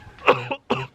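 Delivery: harsh cough. Real, not acted. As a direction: cough mid-word. Sick, can't talk.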